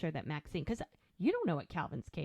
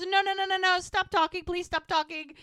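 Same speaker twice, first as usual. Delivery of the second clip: high, loud mock panic; acted-out begging, pitched way up; frantic pleading; 10 semitones higher, 8 dB louder than usual. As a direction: cut her off with hammed-up desperation. Hope frantically cuts her off.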